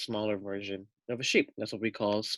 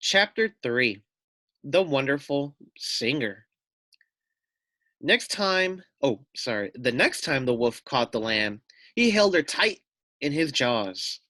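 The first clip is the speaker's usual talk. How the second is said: put-on lively, louder read-aloud voice for kids; storybook narration; 4 semitones higher, 4 dB louder than usual. A narrator's reading. Teacher reading a picture book to children, animated narration.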